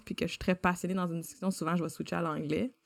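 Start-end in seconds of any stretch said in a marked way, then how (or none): none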